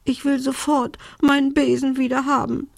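high, whiny storybook sob; acted sobbing. A narrator's reading. Little witch wants her broom back, sobbing. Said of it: "schluchzte Belinda".

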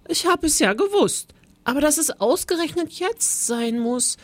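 high little teacher's voice; mousy high voice of a timid teacher; small quiet voice. Acted. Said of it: "mit seiner kleinen, leisen Stimme".

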